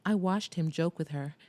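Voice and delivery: plain reading voice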